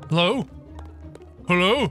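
normal talking voice

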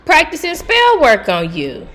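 high, loud and slow; loud indignant rant. Real, not acted. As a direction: call them out loudly. Outburst about spell work.

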